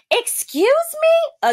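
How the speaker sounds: very high, slow, drawn-out excuse me; mock affronted, high and slow; snippy challenge. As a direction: stretch it out high with attitude. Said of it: "turns around and says".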